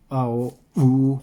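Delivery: vowels drawn out very slowly; slow Chinese vowels. Shown as a demonstration. Teacher contrasting Chinese vowels with English for students. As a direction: stretch each vowel sound long. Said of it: "यहाँ पे different हो जाता है".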